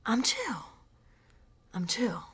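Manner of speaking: soft repeated reassurance to oneself, fading to a whisper; self-soothing. Acted. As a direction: talk yourself down, quietly. Calming herself down.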